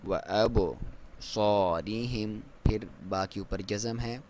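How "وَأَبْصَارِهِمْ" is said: slow drawn-out tajweed chant; Quran recitation. Shown as a demonstration. Teacher recites the verse for students, slow chant. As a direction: recite it slowly with full tajweed. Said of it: "آپ نے کلکلا کرنا ہے".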